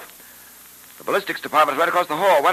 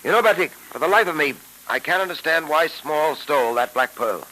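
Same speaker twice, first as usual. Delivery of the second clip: put-on old radio show. Old-time radio drama episode.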